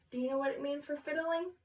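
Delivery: usual speaking voice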